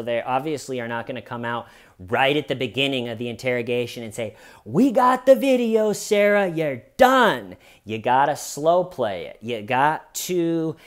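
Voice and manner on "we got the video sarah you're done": loud, high call-out, falling to the end; exaggerated detective's announcement, pitched way up; mock triumphant accusation. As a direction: burst out with a hammed-up gotcha. Acting detectives' blunt accusation.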